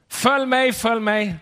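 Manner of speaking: high, loud repeated call; calling again. Retold or imitated. Tourist calls again, no response. (turisten ropar igen)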